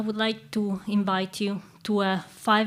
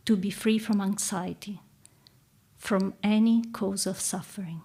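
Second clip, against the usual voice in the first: calm meditative tone. Acted. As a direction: guide them gently into stillness. Leads a guided reflection.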